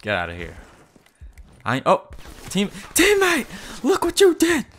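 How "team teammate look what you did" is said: high, loud accusing shout; yelling at a teammate who ruined the play; exasperated yell. Real, not acted. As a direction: blame the teammate loudly.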